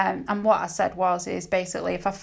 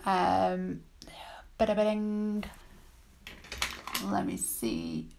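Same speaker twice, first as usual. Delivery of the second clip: whispered thinking-aloud hum; whispering; 5 dB quieter, slower than usual. Real, not acted. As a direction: murmur under breath while searching. Thinking aloud while searching.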